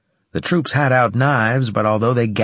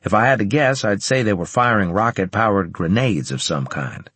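plain reading voice

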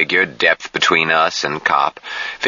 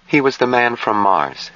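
higher-pitched, drained statement with falling end; flat voice; 7 semitones higher than usual. A narrator's reading. Jill answers who he was, in a flat voice. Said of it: "she answered in a flat voice".

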